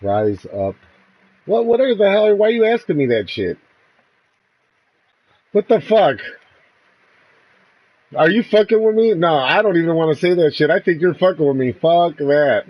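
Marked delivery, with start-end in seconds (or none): 1.5-12.6 loud, higher-pitched angry protest; indignant outburst. Real, not acted. Player suspecting a trick in the word game, indignant outburst. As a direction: refuse loudly, suspecting you're being set up.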